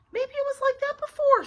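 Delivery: put-on very high, loud girl's voice; high-pitched, eager offering; hopeful suggestion. A narrator's reading. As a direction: pipe up with a helpful excuse. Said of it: "suggested Ivy".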